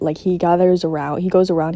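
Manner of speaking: ordinary talk, nothing marked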